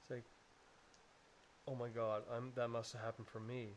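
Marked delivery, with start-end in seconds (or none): 1.7-3.7 low, soft mock horror; hushed, low fake confession; feigned dismay. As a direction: act quietly mortified, voice sinking.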